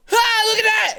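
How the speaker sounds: high, loud taunting voice; mocking gloat. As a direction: gloat like a smug winner. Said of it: "but don't go".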